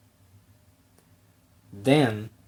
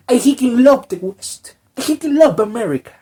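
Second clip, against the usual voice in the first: high and louder, overexcited shouting; mock loud declaration; 9 semitones higher, 6 dB louder than usual. Kojima declaring love for the West, hammed-up shout. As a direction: yell it like a converted fanboy. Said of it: "and he says".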